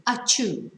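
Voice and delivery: a quick pretend sneeze; mock sneeze. Shown as a demonstration. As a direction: fake a sneeze, achoo. Imitates a sneeze.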